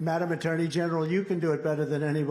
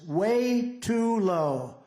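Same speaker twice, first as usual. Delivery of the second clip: slow, higher, drawn-out mockery of her words; mocking quote; 5 semitones higher, 4 dB louder, much slower than usual. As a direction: stretch it out to ridicule the quote. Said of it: "quote, her words".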